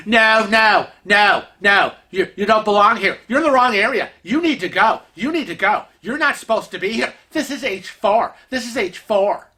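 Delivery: repetitive, hammered-home mock scolding; imitated insistent arguing. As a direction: talk over them like a stubborn busybody. Imitates lady arguing over Kevin, not listening.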